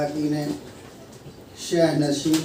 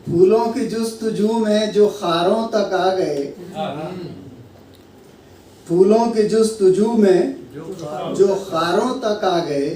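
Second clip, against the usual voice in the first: measured mushaira delivery, lines repeated with more lift; Urdu ghazal recitation; 3 semitones higher, 6 dB louder than usual. Acted. Poet reciting a ghazal to an appreciative gathering, declaimed. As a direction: recite each phrase with weight, pausing for praise.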